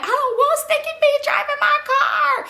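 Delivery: mock wail, pitched very high; drawn-out, high drunken whine; whiny protest. Acted drunken whining protest.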